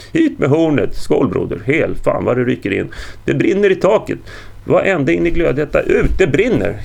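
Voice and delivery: loud, high shouts building to alarm; boisterous alarm. A narrator's reading. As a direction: go from rowdy toasting to crying fire. Men in hall discover fire overhead.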